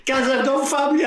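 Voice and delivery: high imitated yelling, clinging on; mock panicked shout. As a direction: cry out like a man hanging off a ladder. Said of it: "He's shouting".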